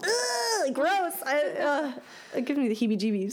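high disgusted ugh, gross; annoyed ugh. Real, not acted. Disgusted at the story.

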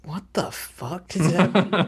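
high, slow, stretched-out disbelief; retold bafflement. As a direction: re-enact a kid's utter confusion. Retelling childhood bafflement at TV phrase.

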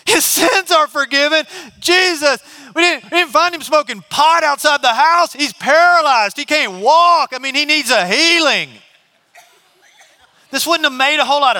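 high, loud, exasperated disbelief; incredulous protest. Acted. Friends on the roof protesting to Jesus, high and incredulous. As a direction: object as if he missed the point.

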